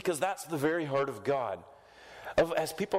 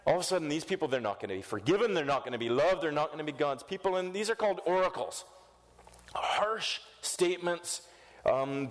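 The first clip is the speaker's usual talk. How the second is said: ordinary talk, nothing marked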